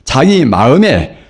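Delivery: ordinary talk, nothing marked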